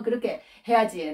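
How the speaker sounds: usual speaking voice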